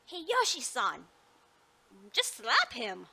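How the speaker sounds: loud, urgent Just slap him; loud exclamation. Acted. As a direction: call it out sharply. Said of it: "I'm, I'm sorry".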